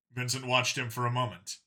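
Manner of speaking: plain reading voice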